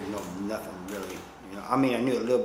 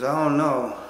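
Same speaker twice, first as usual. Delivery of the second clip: a hint of the other man, louder and higher; hesitant guy imitated; 5 semitones higher, 9 dB louder, slower than usual. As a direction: lightly act out his doubtful reply. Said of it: "He goes".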